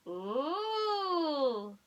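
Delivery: high wondering ooh; ooh cry. A narrator's reading. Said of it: "they cried".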